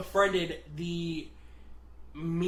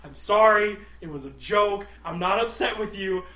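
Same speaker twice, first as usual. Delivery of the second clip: louder, urgent mock backpedalling; retold hasty apology; 5 semitones higher, 8 dB louder than usual. Box guy calming crying coworker, retold urgent apology. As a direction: hurry to undo it, acted. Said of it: "I've gone way too far".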